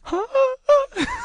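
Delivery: very high, wounded gasp; heartbroken gasp. Retold or imitated. Storyteller reliving seeing her kiss another guy, heartbroken gasp. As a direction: gasp as if your heart just broke. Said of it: "pinpoint the moment that his heart breaks".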